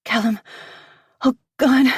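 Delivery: high, loud breathless cry; gasping cry. Acted. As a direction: gasp it out near climax. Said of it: "I manage to gasp".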